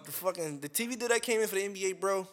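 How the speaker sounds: ordinary talk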